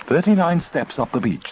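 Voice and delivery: map voice. A narrator's reading. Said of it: "said the map".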